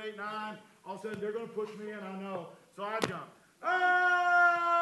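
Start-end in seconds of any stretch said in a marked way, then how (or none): 3.6-4.7 long, high falling scream; falling yell. Retold or imitated. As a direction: hold one high yell all the way down. Acting out his jump.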